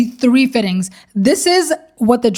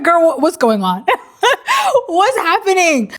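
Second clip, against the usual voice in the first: retold worry through laughter; 6 semitones higher than usual. Retelling the bride's alarm at the fitting, laughing worry. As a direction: voice the worried bride while cracking up. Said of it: "Alexandra is even more worried".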